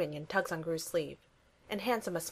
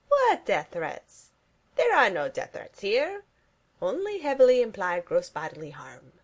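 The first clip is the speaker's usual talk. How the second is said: villain Gru; 3 semitones higher, 7 dB louder than usual. Acted. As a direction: play the supervillain dad with mock innocence. Gru voiced menacing Steve.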